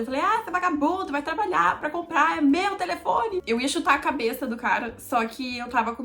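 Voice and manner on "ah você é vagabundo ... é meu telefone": mock furious scolding, higher; a touch of high angry shouting; angry yelling. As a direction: tell off the thief in retold rage. Kicking the robber in anger.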